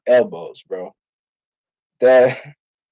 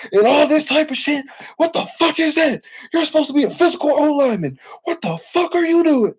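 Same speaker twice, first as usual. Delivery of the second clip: much higher, furious chewing-out; retold yelling coach; 13 semitones higher than usual. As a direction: imitate the coach tearing into him. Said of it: "yelling at me".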